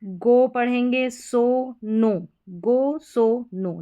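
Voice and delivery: very slow, every word drawn out; teacher slowly spells out the final O sound; slow model reading. Shown as a demonstration. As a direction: stretch out गो, सो, नो for the learners. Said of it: "इसको".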